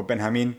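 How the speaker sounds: Benjamin as "ben-ha-MEEN"; Spanish pronunciation. Retold or imitated. Coach introducing himself in Spanish, Benjamin pronounced ben-ha-MEEN. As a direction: say Benjamin with a Spanish h-like j and stressed last syllable. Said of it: "Spanish pronunciation of my name".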